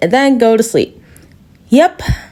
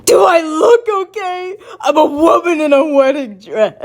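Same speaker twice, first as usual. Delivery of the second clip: higher, crying and sarcastic; tearful retort; 4 semitones higher than usual. Acted. Thumbelina answering the bat through tears, sarcastic and crying.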